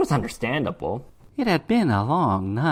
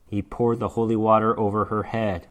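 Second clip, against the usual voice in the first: ordinary delivery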